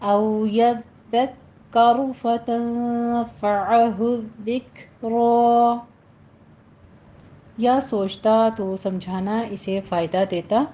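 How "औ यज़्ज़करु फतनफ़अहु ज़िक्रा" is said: slow measured Quranic chant; tajweed recitation. Shown as a demonstration. Teacher models the verse for students, slow recitation. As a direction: draw each vowel out like a reciter.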